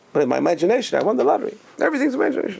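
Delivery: excited imaginary winner; acted glee. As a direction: voice climbing high in mock triumph. Voices person defending imagined lottery win.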